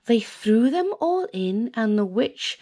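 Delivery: ordinary delivery, nothing marked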